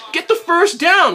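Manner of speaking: exasperated. Real, not acted. Fan yelling at play call.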